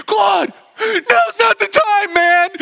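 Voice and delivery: very high, drawn-out full shout; shaking with panic. Retold or imitated. Panicked driver yelling at meddling back-seat friend, hysterical. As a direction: explode at your friend in comic hysteria. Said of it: "he's, like, shaking".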